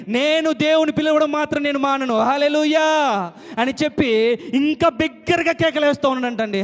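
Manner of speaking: normal talking voice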